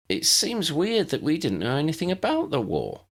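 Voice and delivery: a hint of a polite first-year; Lydia the new girl. Lydia voiced in the story.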